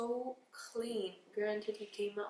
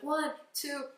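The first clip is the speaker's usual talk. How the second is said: mom counting through tears, a bit louder and higher; tearful counting mom; 4 semitones higher, 6 dB louder than usual. Retold or imitated. Mom counting pushes, a touch of tearful counting. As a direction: count like you're about to cry. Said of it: "she was so emotional that she was like".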